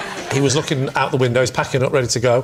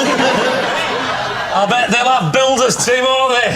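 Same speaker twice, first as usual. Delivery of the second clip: slow, higher broad northern drawl; northern accent; 7 semitones higher, much slower than usual. Retold or imitated. To the electrician, chatting in a put-on northern accent. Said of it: "getting more northern".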